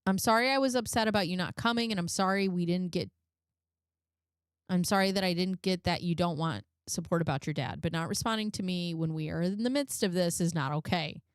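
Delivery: usual speaking voice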